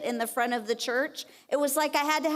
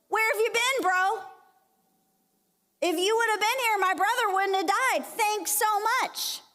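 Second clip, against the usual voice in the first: higher, sharp reproachful outburst; angry accusation; 7 semitones higher than usual. Retold or imitated. Martha at the gate scolding Jesus, higher and indignant. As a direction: confront him hotly, then a sarcastic thanks. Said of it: "She's mad".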